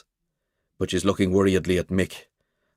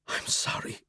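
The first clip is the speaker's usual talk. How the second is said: breathless unvoiced whisper; 10 dB quieter than usual. A narrator's reading. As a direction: barely breathe the apology. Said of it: "My voice comes out a whisper".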